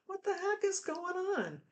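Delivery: sudden high squeak of confusion; exasperated disbelief. Retold or imitated. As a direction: pitch it way up, baffled by your own body. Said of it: "you feel like".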